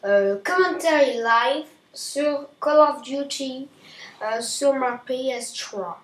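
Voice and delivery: loud, hammed-up fake English accent on French; jokey English-accented French intro; mock English accent. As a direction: greet the viewers in a put-on English accent. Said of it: "j'arrête l'accent anglais".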